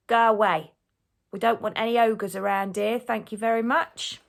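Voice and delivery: loud shout through a letterbox; shouting gatekeeper. A narrator's reading. Gatekeeper sends ogre away through the letterbox, shouting. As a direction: shout them away, firmly. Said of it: "gatekeeper shouts through the letterbox".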